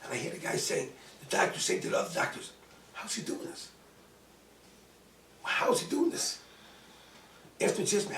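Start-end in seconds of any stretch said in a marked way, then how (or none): 2.9-3.7 hushed wonder. Retold or imitated. Doctor wondering aside to colleagues. 5.4-6.4 high-pitched, incredulous question; astonished. Retold or imitated. Doctor's astonished repeat to colleagues.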